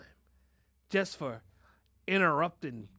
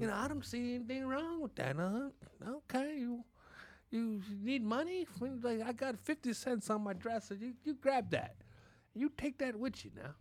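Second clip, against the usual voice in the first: lightly voiced, higher, soft elder offering money; imitating grandparent; 6 semitones higher, 7 dB quieter than usual. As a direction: grandparent giving grandkid pocket change. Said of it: "that's how grandparents talk".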